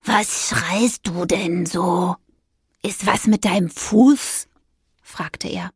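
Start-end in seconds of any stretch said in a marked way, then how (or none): 0.0-4.5 slow, drawn-out cat voice; unruffled cat character. Acted. Cat asking witch about her shouting, slow and unruffled. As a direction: stretch the words, coolly puzzled.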